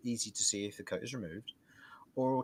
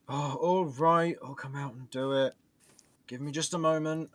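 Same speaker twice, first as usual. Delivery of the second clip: grudging reply; 3 semitones higher, 5 dB louder than usual. Acted. GM voices grudging butler.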